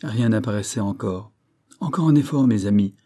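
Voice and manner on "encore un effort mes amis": loud, higher rallying call; rally the team with a shout. Acted. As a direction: Banks urging the men, loud. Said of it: "cria Banks".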